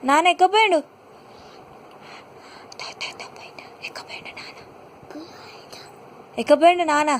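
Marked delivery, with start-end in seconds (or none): 2.8-4.6 playful secret whisper. Pretend. Parent whispering playfully to toddler Ammulu. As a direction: hush it like a secret game for the baby.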